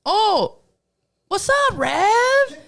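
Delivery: high, drawn-out greeting; imitating overfamiliar guy. Stranger claiming to know Reverie, high slow mock greeting. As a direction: stretch it out high like a long-lost pal.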